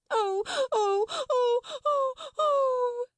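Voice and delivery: loud, very high wailing sobs; tempestuous howling. A narrator's reading. Lottie drowning out Miss Amelia, tempestuous howls. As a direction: howl in repeated high bursts. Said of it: "Lottie howled tempestuously".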